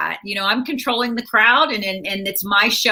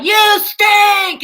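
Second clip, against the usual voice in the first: high mock heckler shout; bratty kid yelling from the crowd; 10 semitones higher, 7 dB louder than usual. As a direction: yell it up high like a rude kid. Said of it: "this one kid yells out".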